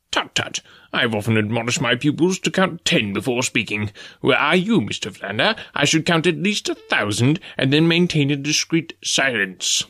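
lightly voiced pedantic old professor; prim lecturing tone, only a touch put on; mildly scolding scholar; fussy professor. A narrator's reading.

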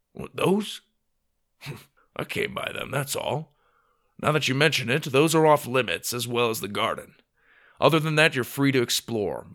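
lightly voiced grumpy old grandpa; mildly gruff dismissal of the kids; a touch of grandfatherly grouchiness. A narrator's reading. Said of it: "not be so grumpy".

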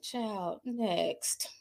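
retelling her own unimpressed reaction, muttered; dismissive murmur. Retold or imitated. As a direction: brush it off under your breath. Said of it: "I was like".

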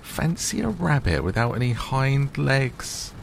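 high, teasing wild rabbit; mock him with a high sneer; lightly voiced rabbit jeering. A narrator's reading.

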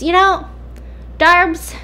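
high-pitched, pointed toying address; acted taunting. Ashley needles Darby before the watchers, acted taunting. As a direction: toy with someone, lifting the pitch high.

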